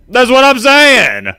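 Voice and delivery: high, slow exclaim of disbelief; exasperated outburst. Real, not acted. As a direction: stretch it out in exasperation.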